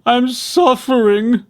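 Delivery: slow, high, loud wail. A narrator's reading. Suffering fox begging the mouse dentist for mercy, wailing. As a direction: plead in pain. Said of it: "the fox wailed".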